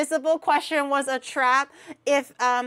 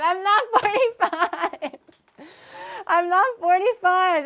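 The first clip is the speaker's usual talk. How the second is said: higher, louder mock-indignant protest; protesting denial; 6 semitones higher, 6 dB louder than usual. Real, not acted. Denying age to chat, protesting.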